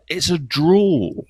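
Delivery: drawn-out Scottish drawl; west of Scotland drawl. Retold or imitated. Radio host describing a pundit's voice, slow west of Scotland drawl. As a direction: stretch it slowly in a west Scottish accent. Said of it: "this kind of west of Scotland".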